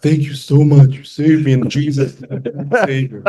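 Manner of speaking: hammed-up grateful outburst; mock gushing gratitude. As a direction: gush like a joking new convert. Imagined gushing thanks he waits for.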